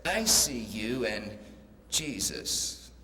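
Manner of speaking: the parrot repeats it, low and unhurried; the parrot answers the flashlight beam, slow and low. Acted. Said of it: "the parrot says".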